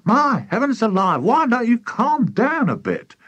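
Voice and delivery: high-pitched scolding character voice; exasperated wife. A narrator's reading. Missus Cranky walks in on her excited husband, exasperated scolding.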